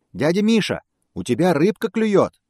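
high, loud cat call; cat shouting. Acted. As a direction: call out to the bear. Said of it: "кричит кот".

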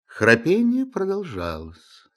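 plain reading voice

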